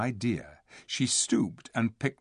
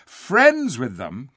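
loud, slow incredulous echo; indignant cry; 3 semitones higher, 8 dB louder, much slower than usual. A narrator's reading. Eustace answering his sister, indignantly incredulous. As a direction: throw the words back in disbelief. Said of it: "cried Eustace".

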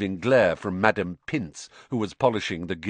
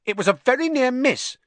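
high, louder schoolboy retort; nervous Ernie; 5 dB louder than usual. A narrator's reading. Ernie answering an angry Harry, nervously insistent.

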